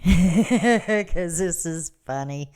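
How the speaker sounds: normal talking voice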